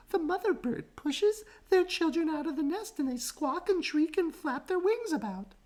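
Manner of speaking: very high, animated telling; kangaroo voice. A narrator's reading. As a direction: describe the birds with fuss. Kangaroo describing birds.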